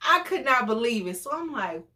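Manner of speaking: ordinary talk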